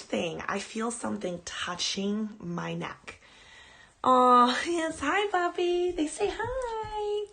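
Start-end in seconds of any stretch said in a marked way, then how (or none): 4.0-7.3 much higher, louder sing-song dog voice; pet talk. Real, not acted. Host coaxes her dog to greet viewers, high loud baby talk. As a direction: coo at the puppy to coax her over.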